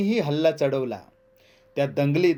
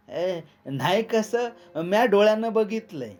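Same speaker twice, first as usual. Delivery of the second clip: put-on insistent little boy; 7 semitones higher than usual. A narrator's reading. Bali's youngest contradicts Chima, high and insistent. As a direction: insist like a child eyewitness.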